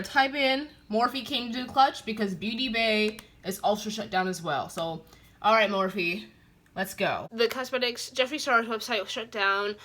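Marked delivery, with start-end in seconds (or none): none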